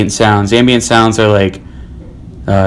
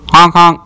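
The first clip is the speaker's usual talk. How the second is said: higher, nasal-free mouth "honk honk" like a car horn; car horn imitation; 7 semitones higher than usual. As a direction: beep like a car stuck in traffic. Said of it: "Here, here is cityscape".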